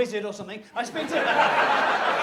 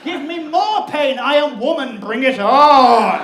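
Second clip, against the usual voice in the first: defiant roar; 3 semitones higher, 12 dB louder, slower than usual. Retold or imitated. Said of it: "I'm in so much pain".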